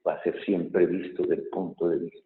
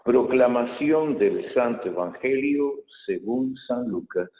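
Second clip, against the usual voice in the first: raised, solemn proclamation; gospel reading; 4 semitones higher, 3 dB louder than usual. Real, not acted. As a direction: read it out to the whole church.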